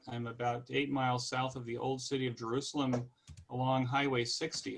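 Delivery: ordinary talk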